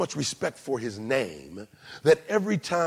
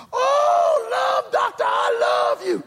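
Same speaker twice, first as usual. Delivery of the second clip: high, loud, squealing fan voice; gushing fan; 5 dB louder, slower than usual. Retold or imitated. Star-struck woman in a bookstore, squealing and shouting with delight. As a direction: gush like a star-struck fan meeting a radio host.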